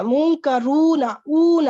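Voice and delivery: "منکرون" drawn out very slowly, letting the "اونا" ending ring; teacher sounding out "منکرون" slowly to expose "اونا"; stressed ending sound. Shown as a demonstration. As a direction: stretch "منکرون", then echo its long "اونا" ending alone. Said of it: "اب یہ اونا کی sound آ رہی ہے".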